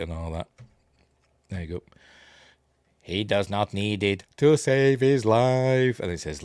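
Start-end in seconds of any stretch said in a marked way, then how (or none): 3.0-6.0 slow, stretched put-on voice; mock film character. Quoting the film's reply, slow mock intoning. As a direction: draw out every word in a mock-solemn chant.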